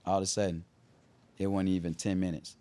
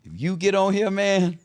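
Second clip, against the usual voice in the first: hyped pitch; 7 semitones higher, 6 dB louder than usual. Retold or imitated. Hyping a verse to [name].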